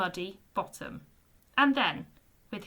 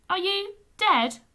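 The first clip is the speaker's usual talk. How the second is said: anxious; 9 semitones higher, 6 dB louder than usual. A narrator's reading. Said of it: "Bungo asked anxiously".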